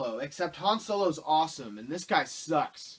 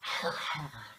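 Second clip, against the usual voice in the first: sigh; 4 dB quieter than usual. Real, not acted. Weary sigh after impression.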